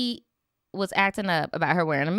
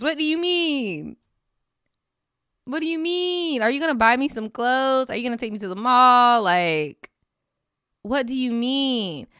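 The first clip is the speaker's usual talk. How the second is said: sassy retort; 4 semitones higher than usual. Acted. Mock retort to controlling man.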